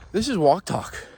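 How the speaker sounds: panting after running uphill; breathless from the climb; out of breath. Real, not acted. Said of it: "Sorry, I'm out of breath".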